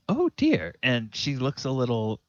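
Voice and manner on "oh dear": higher, a touch alarmed; a flustered mother's gasp; high worried oh dear. Acted. Farm woman reacting to the wolf, high and worried. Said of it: "she says".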